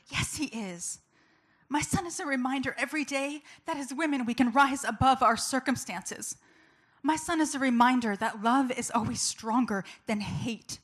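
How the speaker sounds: loud, high, ringing declaration; rally the room with full conviction; impassioned. Real, not acted. Mother declaring her son a reminder, impassioned. Impassioned climax of pro-life speech.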